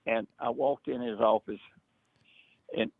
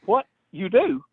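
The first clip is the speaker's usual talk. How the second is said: much higher, imitating a startled friend; a high, astonished mock question; surprised; 12 semitones higher, 4 dB louder than usual. Friend surprised by big order.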